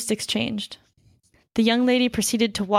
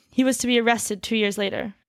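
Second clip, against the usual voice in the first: ordinary delivery, nothing marked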